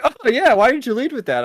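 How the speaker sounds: high, breezy imitation of a too-easygoing woman; mock airy acceptance. Mocking the scientist's easy acceptance, lightly. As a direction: toss it off lightly, high and unbothered. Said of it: "she's just like".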